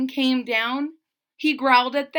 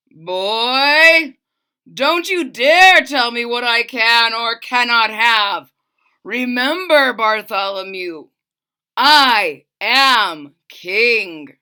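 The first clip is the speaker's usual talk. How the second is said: loud, slow royal scolding; very slow, weighty I am king; angry king; 10 dB louder, slower than usual. Acted. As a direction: put the boy in his place. King scolding the page boy.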